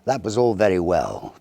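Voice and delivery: plain reading voice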